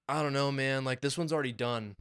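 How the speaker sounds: ordinary delivery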